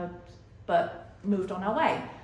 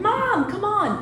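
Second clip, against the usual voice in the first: put-on high pleading little boy, much higher; whiny toddler; 11 semitones higher than usual. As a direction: whine it like a kid tugging at mom. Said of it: "And he's like".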